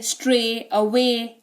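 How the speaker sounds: स्ट्रे and अवे each ending on a pure "e"; correct pronunciation demo. Shown as a demonstration. Teacher modelling for learners, स्ट्रे and अवे ending in "ay". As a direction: say स्ट्रे, अवे as "stray, away", no y sounded. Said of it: "ऐसे उच्चारण हो रहा है".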